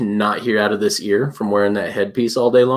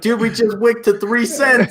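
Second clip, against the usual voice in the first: mock panic; 10 semitones higher, slower than usual. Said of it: "Be like".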